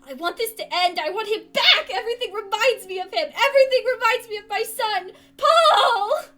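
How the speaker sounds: piercing high grief-stricken scream. Acted. Mother screaming for her lost son. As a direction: scream it from the top of your lungs. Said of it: "she screamed from the top of her lungs".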